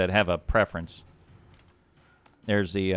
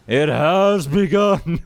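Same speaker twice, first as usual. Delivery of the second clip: dramatic announcement; 6 semitones higher, 7 dB louder, slower than usual. Pretend. Host announces match start dramatically.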